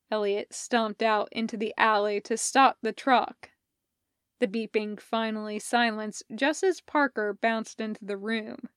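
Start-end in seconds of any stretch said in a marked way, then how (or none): none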